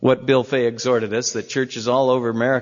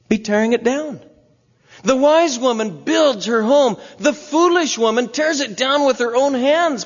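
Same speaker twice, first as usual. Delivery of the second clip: high, louder fervent preaching push; preaching at full force; 11 semitones higher, 3 dB louder than usual. Real, not acted. Preacher rousing the church about evangelism, fervent and loud. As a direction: drive the point home to the congregation.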